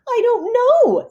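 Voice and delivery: high, loud dazed voice; small high Splat. Acted. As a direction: squeak it from under the chips. Splat buried under chocolate chips.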